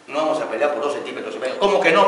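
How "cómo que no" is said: indignant. Retold or imitated. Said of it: "Me dijo".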